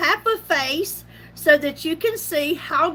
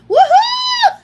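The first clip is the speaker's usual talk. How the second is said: high, loud shouted whoop; excited whoop; 10 dB louder than usual. Retold or imitated. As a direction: cheer like you just won the lottery. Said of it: "hearing me going".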